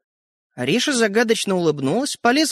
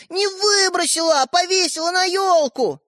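very high, insistent correction; desperate protest; 12 semitones higher than usual. A narrator's reading. Younger brother corrects sister in quarrel, desperate. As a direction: plead the point at high pitch. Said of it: "задыхаясь от отчаяния".

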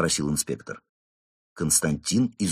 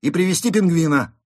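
raised, loud official order; commanding; 10 semitones higher, 8 dB louder than usual. A narrator's reading. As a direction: call out the next command. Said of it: "приказал инспектор". (громкий приказ)

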